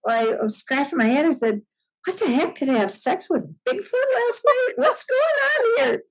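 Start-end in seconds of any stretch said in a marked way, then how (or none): 2.0-6.0 much higher, hammed-up disbelief; retelling a comic freak-out; mock shock. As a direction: play up the bewildered outrage. Acting out morning shock.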